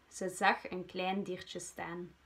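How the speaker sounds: ordinary delivery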